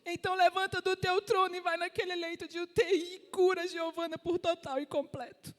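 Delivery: high-pitched fervent prayer; urgent, elevated declaration to God; declaring in prayer. Retold or imitated. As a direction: retell a bold prayer, pitch held high. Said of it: "a declarar naquele quarto, a orar".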